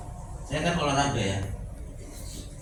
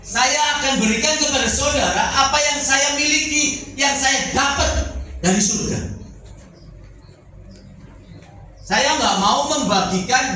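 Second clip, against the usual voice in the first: forceful preaching; 9 semitones higher than usual. Acted. Preacher urging congregation to share his experience, forceful preaching. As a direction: drive each phrase out to the congregation.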